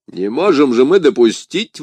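громкое возражение; objecting. A narrator's reading. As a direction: push back at the plan. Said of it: "возразил Портос".

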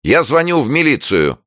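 high, loud threat; threatening neighbour. A narrator's reading. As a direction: warn them at full pitch. Neighbour threatens from behind door. (громкая угроза соседки)

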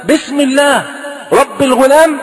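high-pitched, ringing declaration; loud proclamation. Retold or imitated. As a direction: project it high to a whole crowd. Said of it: "بصوت عالي بقى".

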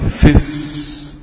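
"في الس-" cut to fisss, short i and held hiss; slowed-down linking. Shown as a demonstration. Teacher slowing it for students, "في الس-" as a drawn-out fisss. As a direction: stretch the s of "في الس-" slowly and stop there. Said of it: "طب ناخدها كده بقى بالبطيء".

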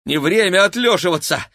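high, loud urgent call; shouting. A narrator's reading. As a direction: shout them to their feet. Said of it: "крикнул Игорь".

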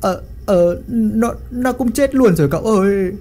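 panicked report. A narrator's reading. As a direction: stammer out the bad news in a panic. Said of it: "hốt hoảng".

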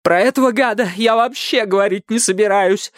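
high, strained tearful refusal; high agitated girl's voice; tearful, breathing heavily. A narrator's reading. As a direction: refuse angrily on the edge of tears. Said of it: "тяжело дыша, и снова залилась слезами".

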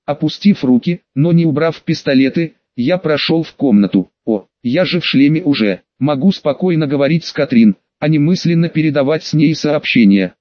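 plain reading voice

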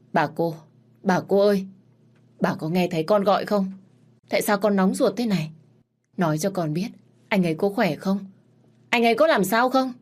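anxious calling out. Acted. She calls out into the void.

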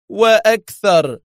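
high, drawn-out gloating; amused after laughing. A narrator's reading. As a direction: stretch it out with relish. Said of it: "ضحك مرة أخرى قائلا".

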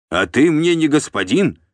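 high, loud defiant retort; insolent. A narrator's reading. Bound steward talking back to the voivode, insolent. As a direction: throw it back at him cheekily. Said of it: "дерзко ответил Тиун".

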